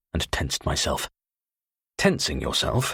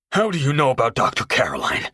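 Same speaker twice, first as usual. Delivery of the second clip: louder, higher hoarse demand; hoarse near-shout; 6 semitones higher, 4 dB louder than usual. Acted. Tony demanding how Carter knows, hoarse near-shout. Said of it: "he demanded hoarsely ... almost a shout".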